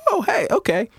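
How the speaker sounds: put-on high, eager voice of a kid saying yes; imitating kids. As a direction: chirp it like an eager child. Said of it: "kids will go".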